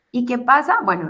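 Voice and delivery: ordinary talk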